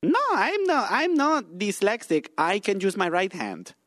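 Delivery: put-on high, drawn-out protest in accent; indignant denial. Guest denying being dyslexic to the host, mock indignation. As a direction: protest it high, then settle back down.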